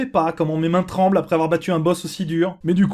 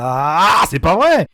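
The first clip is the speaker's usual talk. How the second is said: loud angry c'est pas vrai; frustrated shout; 5 dB louder, much slower than usual. Real, not acted. Boss fight frustration outburst.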